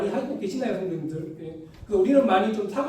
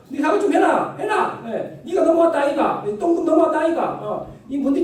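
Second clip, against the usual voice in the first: high, loud Gyeongsang shouting; angry Gyeongsang girl; 7 semitones higher, 4 dB louder than usual. Retold or imitated. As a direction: yell at the deskmate in Gyeongsang dialect. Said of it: "그 여자친구가".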